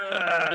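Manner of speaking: strained lifting noise, hammed up; imitated straining grunt. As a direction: imitate struggling to lift someone. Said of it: "grunting and, like, scrunching his face".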